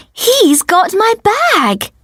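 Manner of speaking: gasping, high-pitched and slow; gasp, then marvel at the good news; drawn-out, high-voiced astonishment; surprised delight. A narrator's reading. Surprised relief at George's text.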